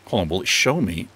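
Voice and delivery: ordinary talk, nothing marked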